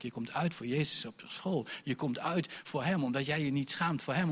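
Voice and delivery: ordinary delivery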